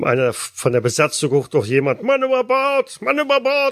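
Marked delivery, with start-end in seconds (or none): 2.0-3.7 high urgent crew shout; shouted alarm. Acted. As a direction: raise the alarm across deck. Crew member calls alarm.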